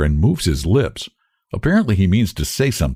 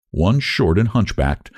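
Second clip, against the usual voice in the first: plain reading voice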